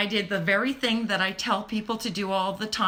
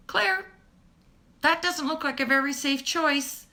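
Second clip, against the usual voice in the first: gentle warning; 7 semitones higher, 3 dB louder than usual. Retold or imitated. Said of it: "I'd say".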